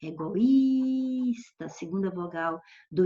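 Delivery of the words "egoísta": "egoísta" with a long, high-held í; lingering stressed vowel. Shown as a demonstration. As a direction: stretch the í in "egoísta" before "sta". Alonga a vogal tônica do exemplo. ("egoísta" demorando no í tônico)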